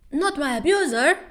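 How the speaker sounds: high gasping mock horror; mock shock. Kim Dan seeing his abuser hit, high mock horror. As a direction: gasp like a melodrama fan.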